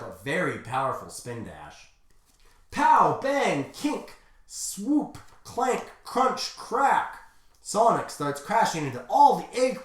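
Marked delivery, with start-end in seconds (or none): 2.7-7.2 high, punched-out impact words; staccato fight noises, pitched up; punchy sound effects. Acted. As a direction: hit each word like a comic-book blow. Reading out comic fight sounds.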